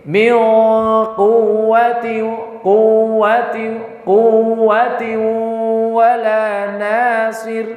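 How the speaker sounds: "quwwati" with the ww merged, no press, repeated slowly; wrong idgham reading. Shown as a demonstration. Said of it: "Contoh seperti ini". ("quwwati" dibaca idgham tanpa hentakan)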